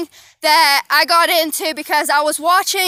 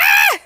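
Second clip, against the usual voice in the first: retold yelp; 4 dB louder than usual. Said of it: "I was like".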